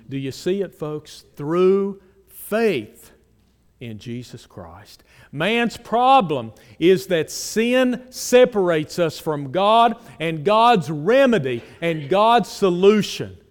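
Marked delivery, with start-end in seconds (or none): 1.3-3.1 "Through faith" raised and loud; emphasized. Shown as a demonstration. Preacher repeating "Through faith" to the congregation, loud and raised. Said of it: "and I emphasize it". 5.3-13.4 forceful preaching. Acted. Preacher declaring God's remedy to the congregation, loud.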